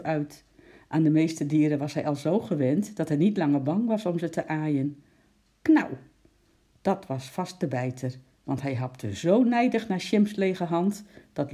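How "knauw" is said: high, loud bird snap; sharp snapping cry. A narrator's reading. Penguin snapping at the monkey's hand. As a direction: bite the air with a squawk.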